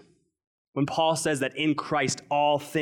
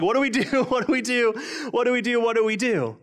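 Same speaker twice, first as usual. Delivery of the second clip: higher, louder hammed-up fretting; mock panic; 8 semitones higher, 3 dB louder than usual. Pastor mocking Twitter's anxiety, mock panic. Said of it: "if you could summarize Twitter".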